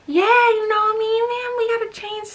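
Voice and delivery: high, playful mock of Special K; guy voice imitation. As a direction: act out his smooth talk lightly mocked. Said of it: "Special K's like".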